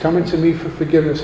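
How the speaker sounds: usual speaking voice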